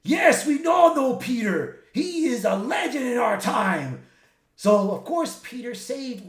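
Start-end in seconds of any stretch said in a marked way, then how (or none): 0.0-4.0 proud shout. Retold or imitated. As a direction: proclaim a hero's legend out loud. Deadpools hail Peter as legend.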